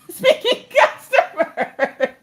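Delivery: words dissolving into high laughter; laughing. Real, not acted. Telling of her manager's calls, really laughing. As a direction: laugh helplessly through the words.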